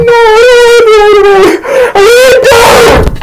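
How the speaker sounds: very high falsetto whine; wailing friend. Retold or imitated. Homie refuses to throw chip away, wailing.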